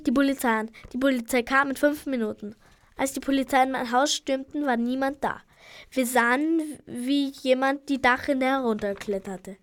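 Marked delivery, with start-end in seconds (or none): none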